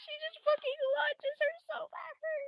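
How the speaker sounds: very high squeaky voice through laughter; helpless laughing squeal. Real, not acted. Creator describes character launching herself, squealing with laughter. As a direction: squeeze the words out while cracking up. Narrating while cracking up.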